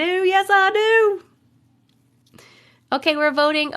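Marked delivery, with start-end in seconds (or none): 0.0-1.2 higher, slow and stretched out; a teasing, stretched yes; drawn-out sing-song. Shown as a demonstration. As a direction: draw it out, high and playful. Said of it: "repeats it drawn out and high".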